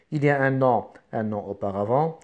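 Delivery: usual speaking voice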